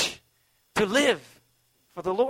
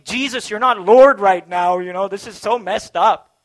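louder mock whine at Jesus; whining complaint; 3 semitones higher, 16 dB louder than usual. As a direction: play a griping believer who complains. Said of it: "that's why whining is so sinful".

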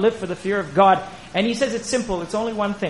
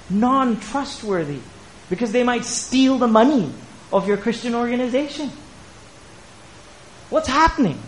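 raised-pitch sermon appeal; impassioned preaching; 5 semitones higher than usual. Real, not acted. Preacher answering an elder who distrusts Christians, impassioned. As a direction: preach it in pained disbelief, pitch lifted.

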